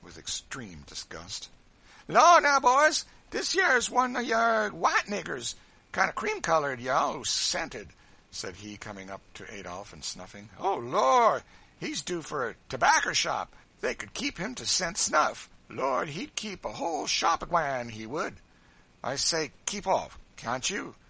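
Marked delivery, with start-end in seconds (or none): 2.1-7.9 high, very loud jeer; boisterous ridicule; mocking, clownish Sambo. A narrator's reading. 10.6-18.4 high, very loud jeer; boisterous ridicule; mocking, clownish Sambo. A narrator's reading. 19.0-21.0 raised, loud warning; indignant outburst; enraged. A narrator's reading.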